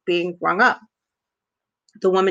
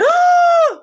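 a sharp, high, loud gasp of shock; imitating a sudden high gasp of disbelief; 7 dB louder than usual. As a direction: gasp like a scandalised lady clutching her pearls. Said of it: "Like, she went".

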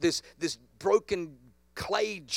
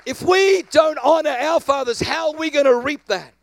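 rising, emphatic pulpit delivery; preaching; 4 semitones higher, 6 dB louder than usual. Acted. Pastor preaching to the congregation with full-force emphasis.

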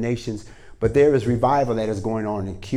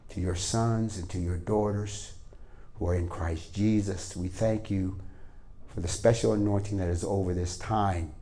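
quiet, earnest prayer voice, a bit softer than the preaching; 5 semitones lower, 9 dB quieter than usual. Acted. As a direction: pray gently over the people. Pastor prays over the congregation.

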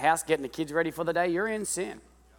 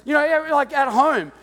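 loud, high, driving sermon delivery; revved-up preacher shouting to the crowd; preaching at full force; 10 semitones higher, 7 dB louder than usual. Real, not acted. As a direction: preach it hard to the room.

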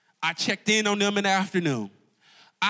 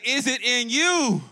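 said with your chest; 5 semitones higher, slower than usual. Shown as a demonstration. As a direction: belt it out from the chest, slowly. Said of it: "say it with your chest".

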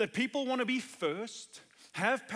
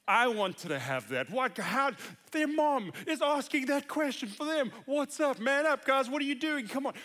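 louder, higher hammed-up complaining; mock indignant disciples; 5 semitones higher, 6 dB louder than usual. Disciples griping that the brothers' mom asked first, loud mock indignation. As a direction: play the jealous disciples griping, half joking. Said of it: "they were indignant with the two brothers".

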